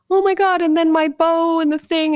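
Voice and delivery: high, whiny mock-crying kid voice; a hammed-up little girl mid-meltdown, pitched high; imitating crying daughter. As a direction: act out a child wailing over her bow. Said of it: "and she's crying".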